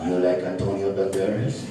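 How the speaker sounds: burrito puppet voice. Acted.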